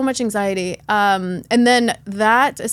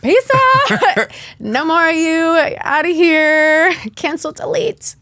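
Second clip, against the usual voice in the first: high, drawn-out mock farewell; gleeful send-off; 7 semitones higher, 5 dB louder, slower than usual. Speaker retells throwing out her Klonopin, high mock dismissal. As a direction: playfully banish it in a high, slow singsong.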